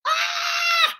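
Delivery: chicken squawk. Pretend. Puppet chicken reacts to being named.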